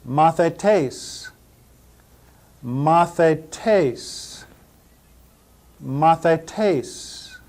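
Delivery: Mathetes as ma-thay-TACE, th as in thin; Mathetes, slow Greek demo; Greek pronunciation. Shown as a demonstration. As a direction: Mathetes sounded out syllable by syllable. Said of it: "Greek vocabulary drill".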